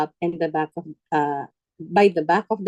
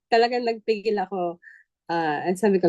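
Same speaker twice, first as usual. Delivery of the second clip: ordinary talk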